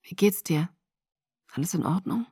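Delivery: soft whispered concern. Acted. Grieving Jacob asks the sick girl, soft whisper. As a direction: ask gently under your breath. Jacob quietly checks on her.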